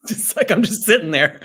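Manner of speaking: chuckling through the words; laughing. Real, not acted. Guest laughing at his own idle sitting, speaking through laughter. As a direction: laugh at yourself while saying it.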